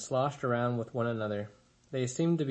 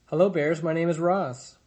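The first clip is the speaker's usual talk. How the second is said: bright loud greeting; 4 semitones higher, 6 dB louder than usual. A narrator's reading. Robot greets the bears brightly.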